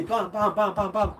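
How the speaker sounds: laughing mock kick noises, quick; kick sounds. Shown as a demonstration. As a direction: voice the fast kicks with a laugh. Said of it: "trente secondes que les pieds". (bruitage de coups de pied en riant)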